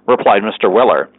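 plain reading voice

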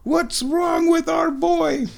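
high, squealing mock panic; freaking-out listeners. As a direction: imitate fans in a frantic high shriek. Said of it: "listeners are probably freaking out".

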